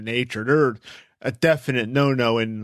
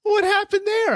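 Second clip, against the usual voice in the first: surprised question; 3 dB louder than usual. Retold or imitated. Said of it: "Dot's like".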